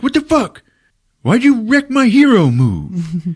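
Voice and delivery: high, squealed comic protest; mock indignant Finn. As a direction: whine in outrage at being thwarted. Finn upset with Rose, retold.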